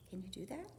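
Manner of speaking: usual speaking voice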